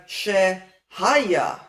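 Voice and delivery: Shehaya slowly, stressing HA in she-HA-ya; stress moved back. Shown as a demonstration. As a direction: lean on HA in Shehaya, letting YA fall. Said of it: "emphasis moves back to the first syllable".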